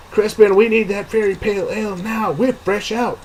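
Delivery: high, hurried exasperated call; fed up, hurry him along; exasperated barmaid. Acted. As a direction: barmaid calling to the dwarf, exasperated. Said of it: "said in an exasperated tone".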